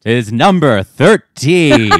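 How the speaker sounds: slow, loud, grand announcer voice; milk it like a quiz show reveal; game show host. Acted. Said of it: "You said that like a game show host".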